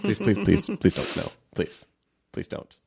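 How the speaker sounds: repeated pleading that fades to almost nothing; mock begging.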